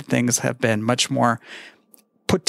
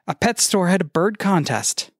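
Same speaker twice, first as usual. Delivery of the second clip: normal talking voice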